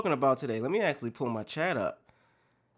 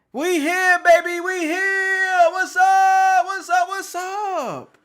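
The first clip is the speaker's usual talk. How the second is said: very high, loud yelling of greetings; excited shout; 13 semitones higher, 8 dB louder, much slower than usual. Real, not acted. Streamer yelling hello to his returning chat, high and loud. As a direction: yell it across the room to the whole crowd.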